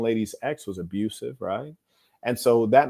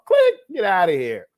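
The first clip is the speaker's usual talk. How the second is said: loud, high mimed hang-up; mock dismissal; 8 semitones higher, 7 dB louder than usual. Man hanging up on a rude caller, loud dismissive call. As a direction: call out the click and send her packing.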